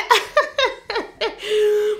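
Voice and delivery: a burst of high, exasperated laughter. Real, not acted. Venting to viewers, she bursts into high laughter. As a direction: laugh it off in disbelief. Laughing at her own anger.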